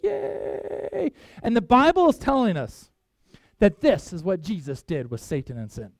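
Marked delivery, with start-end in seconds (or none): 0.0-1.1 high, mock cheer of a crowd; a light mock cheer. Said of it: "everybody'd be like".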